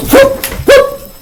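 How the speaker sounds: high squealing whoop; a sharp high whoop for the car swerving; imitated tire-squeal whoop; whoop sound. Imitating dodging a pedestrian.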